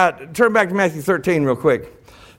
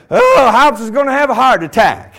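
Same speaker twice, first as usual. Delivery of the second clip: loud, high opening cry; mock alarm; 4 semitones higher, 7 dB louder than usual. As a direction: exclaim like a worried onlooker. Onlooker alarmed at excited preacher.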